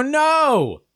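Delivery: high-pitched, drawn-out cry; comic wail of refusal; mock-panicked refusal. Driver refusing the warranty salesperson, mock panic.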